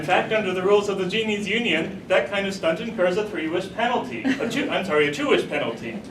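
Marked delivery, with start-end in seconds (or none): none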